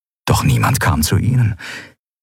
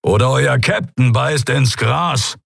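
menacing threat; 5 semitones higher, slower than usual. Acted. Keru threatens the pirates.

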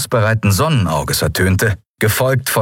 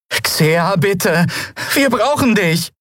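desperate plea; 13 semitones higher, slower than usual. A narrator's reading. Miko pleading with friend.

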